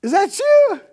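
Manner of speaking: high, eager disciple calling out; Peter. Retold or imitated. Peter asking Jesus to let him out of the boat, eager call. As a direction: call up high, bursting to come. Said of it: "Why was he so quick to go".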